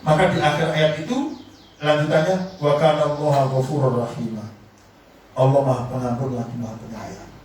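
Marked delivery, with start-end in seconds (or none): 2.6-4.4 lower, measured reciting tone; Quranic recitation. Acted. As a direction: recite it like a verse in prayer. (lantunan ayat Al-Quran yang khidmat)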